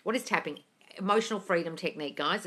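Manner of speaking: ordinary talk, nothing marked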